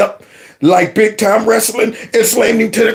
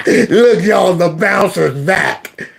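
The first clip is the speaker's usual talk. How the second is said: taunting; 2 semitones lower, much slower than usual. Retold or imitated. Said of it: "And Taji said".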